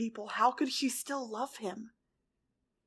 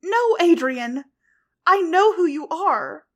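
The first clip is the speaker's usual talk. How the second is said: higher, loud desperate cry; urgent plea; 6 semitones higher, 14 dB louder, slower than usual. A narrator's reading. Ladybug holding Cat Blanc, pleading loudly. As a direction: shout to break through.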